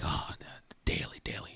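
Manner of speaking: hushed, breathy imitation of a fake greeting; mock whisper. Imitating a phony church musician's greeting, whispered. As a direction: whisper it like a two-faced colleague.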